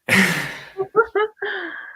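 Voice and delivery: bright high giggle; high laugh. Real, not acted. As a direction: laugh along, amused. Host's embarrassed self-consciousness.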